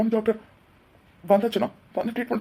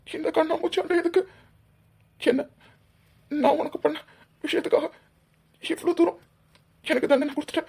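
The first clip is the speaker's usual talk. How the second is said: much higher, tearful pleading with breaking sobs; crying plea; 6 semitones higher than usual. Acted. Husband crying out for his vanished wife, sobbing. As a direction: beg her to come back, voice cracking with tears.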